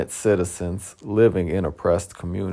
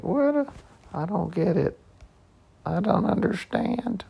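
high, soft, mock-innocent clueless shrug; shrug emoji voice; 9 semitones higher, 6 dB quieter than usual. To Facebook, feigning innocent confusion. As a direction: play dumb like a shrugging emoji, lightly. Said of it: "I had that little shrug emoji, like".

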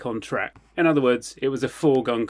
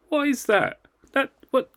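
much higher, startled question; surprised; 13 semitones higher than usual. Real, not acted. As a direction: react in surprise. Spotting friend's drink, surprised.

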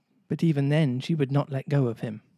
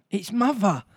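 higher and louder, a joyful discovery; excited cry; 7 semitones higher, 4 dB louder than usual. Acted. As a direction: call it out on seeing her. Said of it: "cried Wendy".

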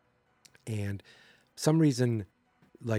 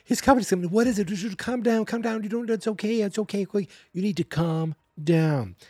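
much higher, frantic pleading voice; high mock panic, slowing at the end; mimicking a panicky plea; 7 semitones higher, 3 dB louder than usual. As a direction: squeak it out like a scared woman. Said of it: "She's like".

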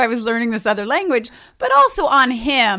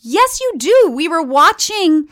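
little brother; 9 semitones higher, 5 dB louder than usual. Retold or imitated. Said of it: "And he was like".

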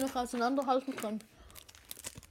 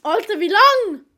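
loud; 5 semitones higher, 11 dB louder than usual. Real, not acted. Impatient while friend eats.

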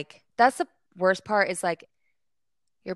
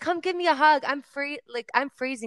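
higher playful [name] voice, ending in a loud cry; imitating [name]; 7 semitones higher, 4 dB louder than usual. [name] asking the fan for a warming hug, high playful imitation. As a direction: coax her in for a hug, up high. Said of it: "She's like".

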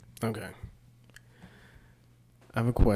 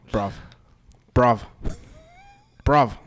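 put-on loud, alarmed British-accented dog; 3 semitones higher, 10 dB louder than usual. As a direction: raise the alarm as a British bruv. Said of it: "he'd have a British accent".

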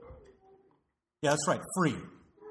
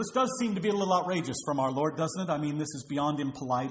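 usual speaking voice

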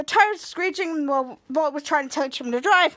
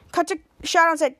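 normal talking voice

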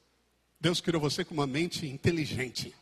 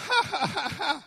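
mocking demon cackle; 9 semitones higher than usual. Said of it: "ele começou".

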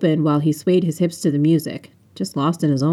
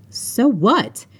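snappy retort, pitched up; irritated, higher-pitched comeback; snapped; 5 semitones higher than usual. A narrator's reading. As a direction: snap it back at him. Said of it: "I snapped".

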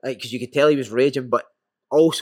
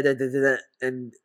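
ordinary delivery

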